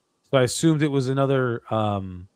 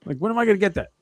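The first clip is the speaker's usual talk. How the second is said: high, louder, pushy demanding tone; mock bill collector; 7 semitones higher, 5 dB louder than usual. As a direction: play the collector chasing his money. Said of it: "pretending like I was the collector".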